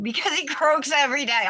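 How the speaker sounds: lively punchline. Real, not acted. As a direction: land the punchline with a lift.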